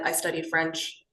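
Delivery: ordinary talk, nothing marked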